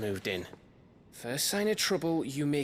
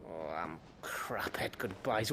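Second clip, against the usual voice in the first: softer, near-whispered confession; choked-up whisper; 6 semitones lower, 6 dB quieter than usual. Acted. Gav saying goodbye to Clive, whispered. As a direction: mumble it, fighting off emotion.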